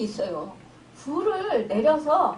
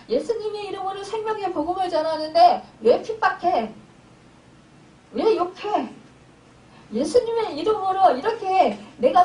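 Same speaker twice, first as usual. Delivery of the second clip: angry protest; 4 semitones higher than usual. Retold or imitated. Disciples protesting at being hindered in Jesus' name, acted angry. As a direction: act out affronted disciples demanding why. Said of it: "화가 난 거예요".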